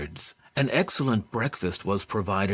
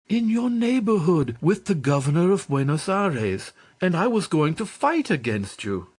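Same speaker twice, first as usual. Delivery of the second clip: eager reply; 7 semitones higher than usual. Acted. Eager reply during the reunion.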